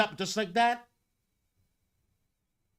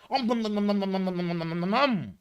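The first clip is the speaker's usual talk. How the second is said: mock munching noises; faster than usual. Mocking gamers gobbling up slop.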